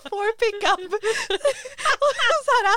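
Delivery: ordinary talk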